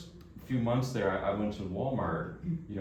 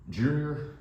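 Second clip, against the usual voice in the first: Texan boss; 5 semitones higher than usual. Retold or imitated. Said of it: "And he says".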